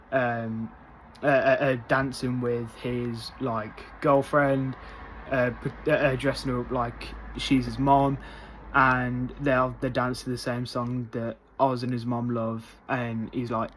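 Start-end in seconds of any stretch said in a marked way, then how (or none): none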